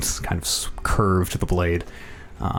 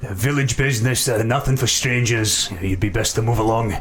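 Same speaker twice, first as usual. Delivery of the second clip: put-on dirty old peasant; 3 semitones higher than usual. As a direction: warn the stranger off as a grubby villager. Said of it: "this very dirty old, uh, peasant".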